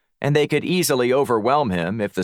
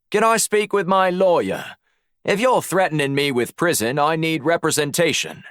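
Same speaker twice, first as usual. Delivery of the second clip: higher-pitched Aussie demand; Australian accent; 8 semitones higher than usual. A narrator's reading. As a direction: press the request in an Australian accent. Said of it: "summoned his best Australian accent".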